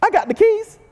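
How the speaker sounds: "keys" sliding up very high, teasing; cocky Peter. Retold or imitated. Peter bragging about the keys, "keys" rising high. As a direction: lift "keys" way up, playful brag. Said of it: "He's like".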